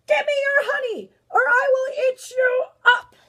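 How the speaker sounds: very loud, high storybook bear roar. Acted. Bear jumps out at grandmother, roaring threat. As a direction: roar the threat out in big bursts. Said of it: "jumped out at her and roared".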